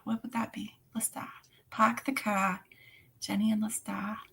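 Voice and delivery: whispered Boston accent. Shown as a demonstration. Whispering Boston phrases to herself.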